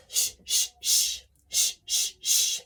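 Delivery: bouncing unvoiced sh bursts from the whole body; bouncy sh pulses. Shown as a demonstration. Coach asking students for bouncier breath pulses, bouncy sh. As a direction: feel each sh in your gut. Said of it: "then make it more bouncy".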